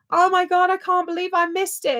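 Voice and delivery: high, whiny mock of a viewer. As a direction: squeal it like the fans who forgot. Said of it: "a lot of people were like".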